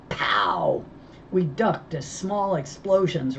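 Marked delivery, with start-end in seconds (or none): 0.1-0.8 explosion sound. Acted.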